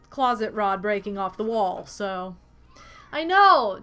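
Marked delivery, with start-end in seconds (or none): 3.1-3.8 loud excited I know; excited exclaim. Real, not acted. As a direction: burst out excited. Excited reaction.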